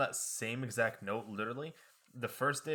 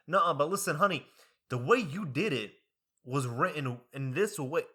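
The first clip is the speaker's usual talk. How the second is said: louder sassy Southern lady; Southern accent; 3 semitones higher, 5 dB louder than usual. Retold or imitated.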